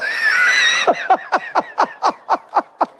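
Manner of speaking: high, breathless laugh of pure joy; joyous laughter; delighted laugh. Real, not acted. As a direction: laugh it out, overwhelmed with happiness. Laughing after friend's news.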